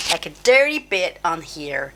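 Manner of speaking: slipping into an American accent, drawn out; a slow, unintended hint of American accent; accidental American accent. Real, not acted.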